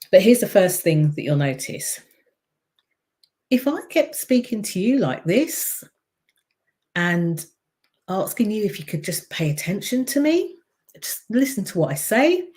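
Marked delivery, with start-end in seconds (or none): 3.5-12.5 high pleading voice. Shown as a demonstration. Coach demonstrating a high pleading voice to the audience. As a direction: pitch everything up high, pleading. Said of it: "if my voice goes up like this".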